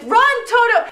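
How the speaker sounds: high, loud playful call; mock cheer. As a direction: egg the dog on through laughter. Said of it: "and, like".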